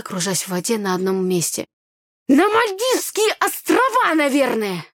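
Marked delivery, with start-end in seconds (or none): 2.3-4.8 high, drawn-out, irritated retort; snapping sarcasm. A narrator's reading. Brother to his clueless sister, snapping sarcastically. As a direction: snap back sarcastically, pitched high. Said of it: "огрызнулся брат".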